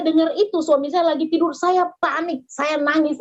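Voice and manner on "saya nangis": normal talking voice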